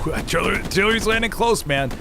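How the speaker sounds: high, urgent artillery warning; alarmed call-out. Real, not acted. To the squad, a high alarmed warning. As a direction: call it out as shells land close. Shells landing near in game.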